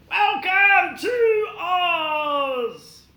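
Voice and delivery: high, slow, cheery call of welcome; friendly greeter. Acted. Friendly folk welcoming Dorothy to Oz, high and drawn out. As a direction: sing out a big welcome.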